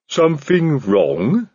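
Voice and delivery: slow troll voice with a different timbre; a calm, slow-witted troll asking; troll Detritus. A narrator's reading.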